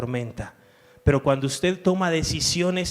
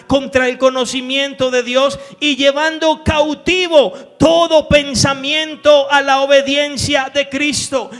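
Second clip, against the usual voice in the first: raised, loud scripture reading with driving force; fervent preaching; 9 semitones higher, 9 dB louder than usual. Acted. Pastor reading Paul's letters aloud to the church, fervently. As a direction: proclaim each verse to the back row.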